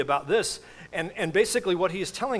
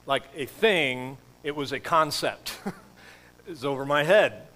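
ordinary delivery

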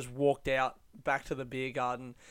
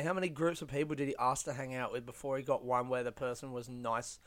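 ordinary talk, nothing marked

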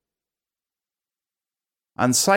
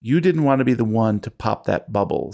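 usual speaking voice

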